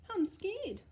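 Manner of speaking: put-on high small voice of a frightened boy; scared little boy. Little Eddie telling his mum he's scared, high and small. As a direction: squeak it out like a scared child. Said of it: "he said".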